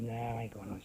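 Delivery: low, soft dismissive mumble; retold brush-off. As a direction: quietly wave off the advice, acting it out. Retold brush-off to concerned woman.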